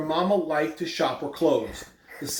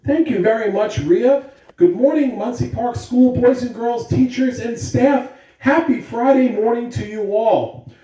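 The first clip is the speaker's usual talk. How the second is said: bright, loud school PA announcement; announcer voice; 4 semitones higher, 6 dB louder than usual. Acted. Principal greeting the school on the morning announcements, loud announcer voice. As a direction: greet the whole school over the intercom.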